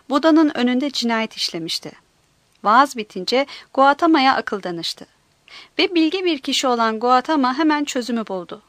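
ordinary delivery, nothing marked

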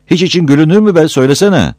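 quick, high, loud challenge; exasperated demand. Acted. Villager refusing an evasive answer about laughing, exasperated.

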